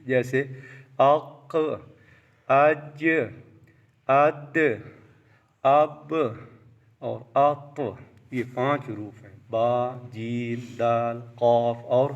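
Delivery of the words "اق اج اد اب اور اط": اق، اج، اد، اب، اط each ending in a small echoing bounce; qalqalah bounce. Shown as a demonstration. Teacher showing the five letters, slight bounce after اق، اج، اد، اب، اط. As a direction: release the last consonant of اق، اج، اد، اب، اط with a short bounce. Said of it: "ان پر جنبش ہوتی ہے تھوڑی".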